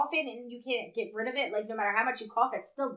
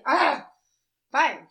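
reluctant; 4 semitones higher, 5 dB louder than usual. Retold or imitated. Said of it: "and I was like".

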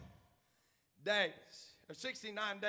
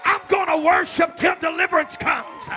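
shouted, driving sermon cadence; preacher urging the congregation to believe; full-force preaching; 8 semitones higher, 19 dB louder than usual. Acted. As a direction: hammer the message home.

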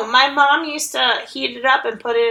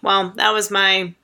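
ordinary delivery

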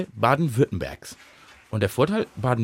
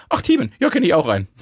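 casual surprise; 4 semitones higher, 4 dB louder than usual. Retold or imitated. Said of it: "der gesagt hat".

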